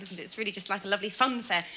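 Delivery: ordinary talk, nothing marked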